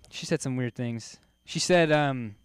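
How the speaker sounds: usual speaking voice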